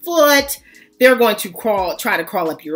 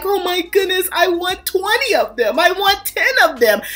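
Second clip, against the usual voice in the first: put-on gushing baby lover; 4 semitones higher than usual. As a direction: coo it, smitten and wishful. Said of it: "and you're like".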